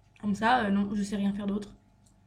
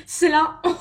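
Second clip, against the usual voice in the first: bursts out laughing, much louder; laughing in embarrassment; 4 semitones higher, 9 dB louder than usual. Real, not acted. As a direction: laugh through the words in shame.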